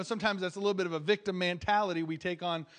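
ordinary talk, nothing marked

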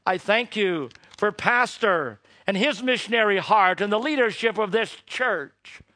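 public prayer. Acted. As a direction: lead the congregation in earnest prayer. Closing prayer.

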